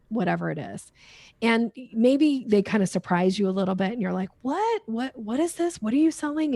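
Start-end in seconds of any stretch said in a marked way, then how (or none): 4.4-6.5 startled and much higher, baffled questions; confused shopper. Retold or imitated. Shopper ambushed by a seller at the store, baffled and higher. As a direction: caught off guard and asking what is going on. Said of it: "you're kinda confused".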